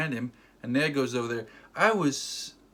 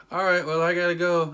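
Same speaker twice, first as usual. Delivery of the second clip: higher, louder hurried excuse; flustered exit; 5 semitones higher, 6 dB louder than usual. Retold or imitated. Hero fleeing her question, loud flustered excuse. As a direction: blurt it as you bolt. Acting hero's hasty exit.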